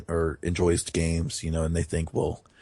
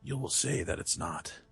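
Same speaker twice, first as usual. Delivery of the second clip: hushed, raspy hero impression, sinking to a whisper; Geralt; 9 dB quieter than usual. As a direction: murmur it softly as the brooding hero. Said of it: "Do you think my Geralt impersonation's terrible?".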